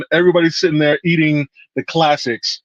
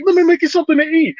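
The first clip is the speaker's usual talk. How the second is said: high, fast, eager mother offering food; doting Italian mom; faster than usual. Retold or imitated. Mother insisting on feeding the boys, high and fast. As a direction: rush to feed them like a caring mama. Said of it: "And she's like".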